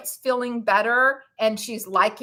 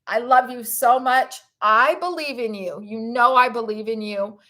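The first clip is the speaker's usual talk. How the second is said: ordinary talk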